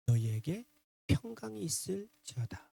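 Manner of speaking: very soft blessing; gentle, unreproachful. Retold or imitated. Jesus to disciples he does not blame, gentle blessing. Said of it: "그들을 책망하지 않으시고".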